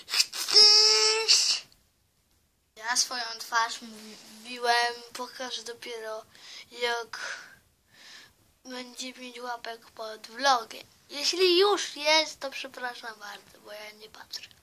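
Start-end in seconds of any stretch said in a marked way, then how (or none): 0.1-1.6 high, loud shouted dare; teddy bellowing the threat; loud taunt. Pretend. 12.4-14.5 hushed quick apology; whispered aside to viewers. Real, not acted.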